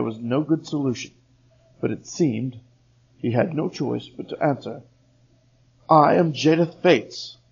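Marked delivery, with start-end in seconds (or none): 5.9-7.4 nervous declaration. Acted. Trapped elf answers loud voice.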